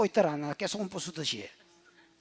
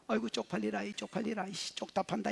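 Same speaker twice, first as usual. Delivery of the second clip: a muttered, much softer mock groan of shame; mock embarrassed grumble; 4 semitones lower, 17 dB quieter than usual. Preacher imitating an ashamed man, softer mock mutter. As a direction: grumble it under your breath like an ashamed man. Judge ashamed of being judged by woman.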